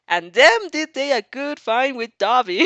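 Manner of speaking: words breaking into real laughter; laughing while reading. Real, not acted. Narrator giggling over the Dobby slip, laughing through.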